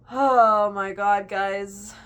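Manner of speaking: a loud, stretched fangirl cry; excited gush. Real, not acted. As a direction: draw out the oh my god, overwhelmed. Gushing about finished book.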